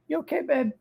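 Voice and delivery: high cooing voice to a dog; pet voice. Real, not acted. Talking to his dog.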